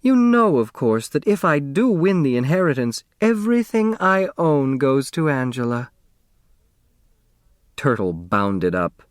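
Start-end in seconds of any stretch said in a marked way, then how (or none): 0.0-5.9 sugary, higher sweet-talk; honeyed. A narrator's reading. Grace sweet-talking Miss Pulaski, voice dripping with honey. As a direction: drip honey on every word. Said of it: "her voice dripping with honey".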